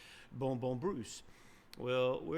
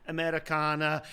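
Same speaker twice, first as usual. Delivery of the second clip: louder, higher pulpit call; mock announcement; 4 semitones higher, 8 dB louder than usual. Missionary explains to the silent congregation, mock announcement. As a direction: imitate announcing into a microphone.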